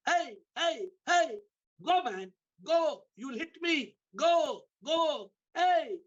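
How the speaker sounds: high, alarmed mock shouts; retold shouting. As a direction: act out calling off an oncoming boat. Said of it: "He started shouting".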